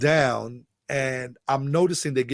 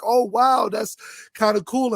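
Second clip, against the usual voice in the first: retold pleased surprise, pitched up; impressed reaction; 9 semitones higher than usual. Man replying to the lady from Brooklyn, impressed.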